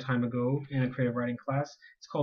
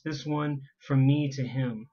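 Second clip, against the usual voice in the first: usual speaking voice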